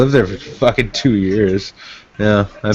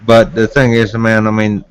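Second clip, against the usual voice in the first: usual speaking voice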